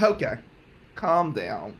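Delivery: ordinary talk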